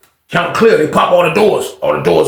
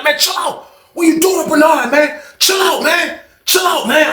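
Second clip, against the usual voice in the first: high shouted pleas; retold crowd yell; 11 semitones higher, slower than usual. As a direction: everyone hollering to stop. Crowd yelling at attacker.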